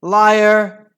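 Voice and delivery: high, loud accusing yell; screech. A narrator's reading. Henry accusing a rival on the pitch, screeching. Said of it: "screeched Henry".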